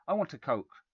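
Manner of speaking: loud, whiny demand; bratty loud want; spoilt boy. A narrator's reading. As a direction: push it like a spoilt child.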